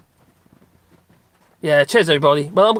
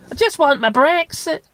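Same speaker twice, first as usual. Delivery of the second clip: much higher whiny plea; mock begging voice; 11 semitones higher than usual. Mimicking the street interview begging voice. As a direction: wheedle it like a petulant child. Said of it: "That's how they go, isn't it?".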